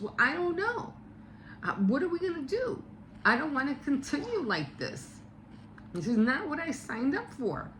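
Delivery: high-pitched exasperated protest; high, mock-upset complaint at normal volume; wife getting mad. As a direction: imitate a wife who is getting fed up. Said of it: "so she's getting mad".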